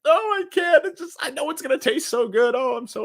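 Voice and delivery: high, squealing mock anticipation; overexcited. Imagining a tasty sandwich, mock giddy high squeal. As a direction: gush over a sandwich you can't wait to eat. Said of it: "you're so excited".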